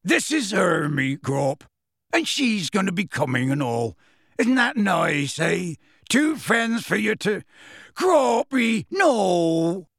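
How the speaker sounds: slow coaxing rising to alarm; coax, then cry out; Hagrid coaxing. A narrator's reading.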